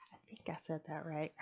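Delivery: whispered aside; whispering. Real, not acted. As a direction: whisper it to yourself. Aside after name.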